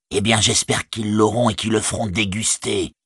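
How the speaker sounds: ordinary delivery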